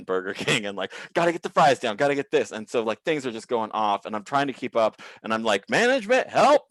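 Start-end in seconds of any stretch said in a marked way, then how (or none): 1.1-2.5 higher, louder kitchen panic; hurried self-talk under pressure; frantic. Retold or imitated. Frantic at work in dream. 5.7-6.6 high, loud cry for backup; overwhelmed worker yelling out; calling for help. Retold or imitated.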